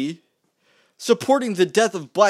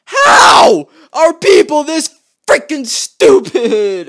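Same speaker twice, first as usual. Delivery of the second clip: much higher, loud, slow yell with a crack; frustrated shout; 8 semitones higher, 10 dB louder, much slower than usual. Real, not acted. Ranting to viewers, a real frustrated shout. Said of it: "I'm actually frustrated".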